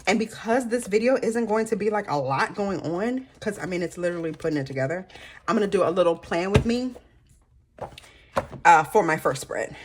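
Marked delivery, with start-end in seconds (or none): none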